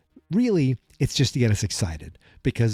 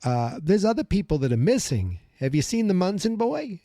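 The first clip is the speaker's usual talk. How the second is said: higher-pitched New York caller voice; New York accent; 6 semitones higher than usual. Pretend. Resident asking police about missing people, New York accent. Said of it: "have a New York accent".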